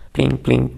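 pling pling up high in a light ping; the ring of stones off a shield; stones clanging. A narrator's reading. Narrator imitating stones hitting the knight's shield, high pings.

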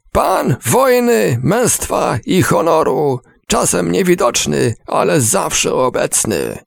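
a propaganda soldier calling the nation to arms; soldier's battle call. A narrator's reading. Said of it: "zakrzyknął". (żołnierz z reklamy wykrzykuje wezwanie do armii, wysoko i uroczyście)

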